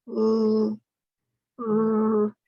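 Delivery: L, R held loudly and steadily; sustained L and R, no vowel; held L and R sounds. Shown as a demonstration. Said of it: "let's do these sounds together".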